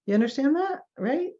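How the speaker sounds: mock explainer seeking a quick yes; glib explanation. Shown as a demonstration. Clinician showing how not to check client understanding, glib. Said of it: "Never say this".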